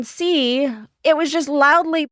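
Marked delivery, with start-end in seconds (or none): 1.5-2.1 LOUDLY pushed up higher; stress on loudly. Real, not acted. Host relaying the convention's claim, stress on loudly. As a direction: lean hard on the word loudly. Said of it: "I stress the word loudly".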